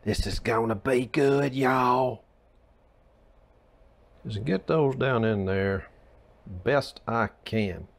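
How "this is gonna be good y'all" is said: Paula Deen. Pretend. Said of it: "I sound like Paula Deen".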